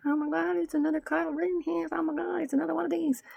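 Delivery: high, breathless mock of online losers; high mocking voice for people online; mocking whiny voice. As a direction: squeal it like a dramatic poster. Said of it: "the losers trying to be like".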